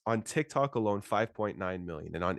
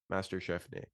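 ordinary talk

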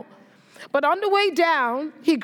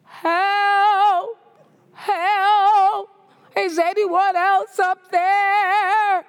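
high, loud, slow sing-song wail of "Help!"; yelling for help; 8 semitones higher, 4 dB louder, much slower than usual. Acted. Jack refuses God's answer and calls for someone else, comic loud wail. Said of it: "Jack yelled".

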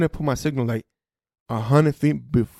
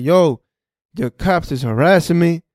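raised, louder retold complaint; mad; 5 semitones higher, 6 dB louder than usual. Driver complaining at the police station about harassment, mad. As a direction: lodge an indignant protest. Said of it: "I'm mad as fuck. I'm like".

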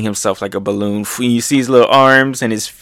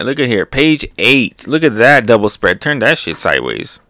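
ordinary delivery

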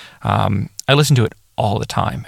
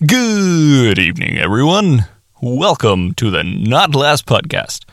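overdone radio greeting; formal radio greeting; 3 semitones higher, slower than usual. Shown as a demonstration. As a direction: stretch it out like a stiff evening broadcast host. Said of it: "Yeah, that's awful".

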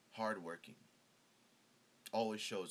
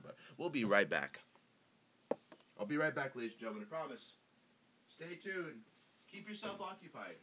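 mock host sign-off; 4 semitones higher, 4 dB louder than usual. Announcing a commercial break.

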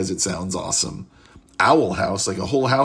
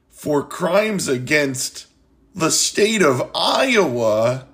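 very slow, grave pronouncement; mock verdict; 4 semitones higher, much slower than usual. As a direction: drag it out like a solemn sentence.

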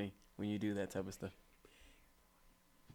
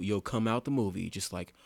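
usual speaking voice

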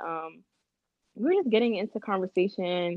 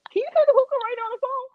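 fast, high, louder put-on voice; high mock voice; 5 dB louder, faster than usual. Retelling the phone call about hookah, fast high mock voice. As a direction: rush it out high in mimicry.

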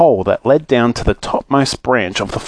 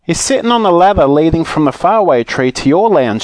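higher, raised-voice explanation; mild shout; 6 semitones higher than usual. Acted. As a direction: call it out to someone hard of hearing. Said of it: "shouted Joe".

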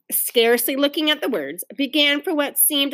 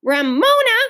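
high, loud exasperated call; raised, protesting cry; 9 semitones higher, 5 dB louder than usual. A narrator's reading. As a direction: call out to a naughty sister. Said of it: "cried Beezus".

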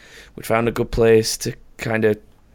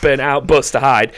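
usual speaking voice

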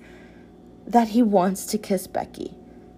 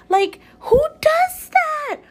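incredulous; 4 dB louder, slower than usual. Real, not acted. Ranting about skirt mishaps.

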